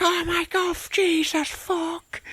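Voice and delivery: high cartoon panic; tiny potato in mock panic; squeaky panic. Potato being skinned.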